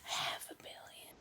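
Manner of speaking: very soft, voiceless echo; stunned whisper. Real, not acted. Viewer repeating a huge sum from the film, stunned whisper. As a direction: whisper it in amazement.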